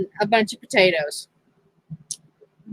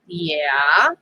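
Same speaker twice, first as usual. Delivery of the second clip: lower, dopey drawn-out yeah; husband's voice; 7 semitones lower than usual. Retold or imitated. Mimicking husband, lower voice. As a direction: mimic the husband, low and dim. Retelling husband's clueless reply.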